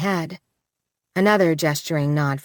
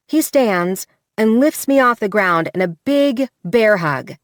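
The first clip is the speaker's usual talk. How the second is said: usual speaking voice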